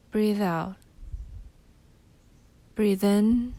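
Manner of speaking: usual speaking voice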